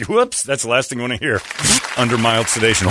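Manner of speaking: ordinary delivery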